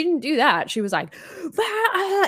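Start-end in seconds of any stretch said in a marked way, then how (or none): 1.6-2.3 high-pitched imitated cry of panic; mock freak-out. Cohost imitating cast member's early-morning meltdown, mock freak-out. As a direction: act out someone losing it. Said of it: "she was like freaking out".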